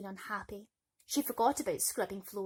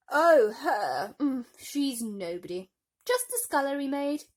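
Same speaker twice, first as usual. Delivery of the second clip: loud scornful dismissal; 5 semitones higher, 10 dB louder than usual. Acted. Stepmother dismissive.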